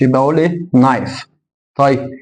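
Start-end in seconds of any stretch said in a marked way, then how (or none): 0.7-1.3 نايف opening directly on n; silent K. Shown as a demonstration. Teacher summing up the rule, silent K. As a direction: say نايف without any k. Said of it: "سايلنت ما بنطقش".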